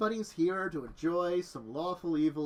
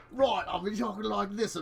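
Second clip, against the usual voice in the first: put-on Australian-ish accent; 4 semitones higher than usual. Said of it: "my terrible Australian-ish accent".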